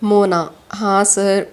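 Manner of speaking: plain reading voice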